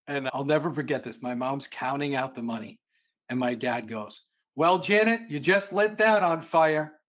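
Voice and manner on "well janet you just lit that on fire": higher, louder, slowed sarcastic jab; imitating dad's dry dig at his wife. Dad mocks mom counting money.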